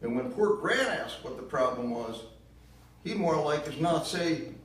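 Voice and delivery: put-on high, loud bitter accusation in a southern accent; bitter southern mother. Ma blaming Gramps for Gran's death, loud southern bitterness. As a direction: spit the blame out in a shrill Southern voice. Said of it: "she sobbed".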